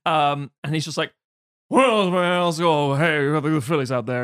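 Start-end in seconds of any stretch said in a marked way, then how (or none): 1.7-4.2 hammed-up incoherent singer impression; drawling nonsense. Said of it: "it's just drawing nonsense".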